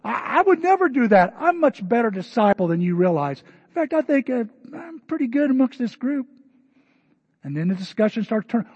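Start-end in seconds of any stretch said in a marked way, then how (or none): 0.0-6.3 high, loud, fast indignant disciple; defensive disciple. Acted. Disciple snapping at another at supper, high and indignant. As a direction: bristle at the accusing look. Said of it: "And somebody goes".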